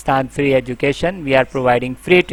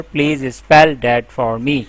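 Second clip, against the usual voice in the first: slow clear request; slower than usual. Shown as a demonstration. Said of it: "you have to tell the customer".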